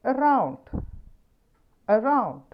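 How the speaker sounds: Around with round high and loud; stress on round. Shown as a demonstration. Teacher repeating Around, round stressed. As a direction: hit round hard in Around. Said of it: "എ എന്നതിന് ശേഷം അവിടെ root word".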